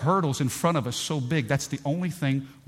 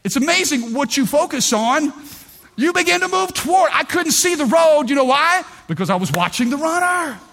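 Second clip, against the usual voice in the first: much higher, louder climactic preaching; preaching at full force; 11 semitones higher, 6 dB louder than usual. Acted. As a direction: drive the point home to the congregation.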